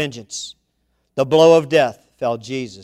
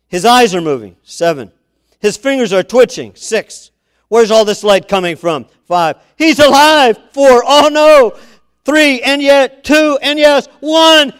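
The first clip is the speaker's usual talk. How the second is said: rising to a much higher, louder climax; building excitement; 8 semitones higher, 10 dB louder than usual. Acted. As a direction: build it till it bursts.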